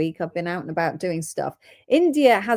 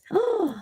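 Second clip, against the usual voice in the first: excited gasp; 14 semitones higher than usual. Real, not acted.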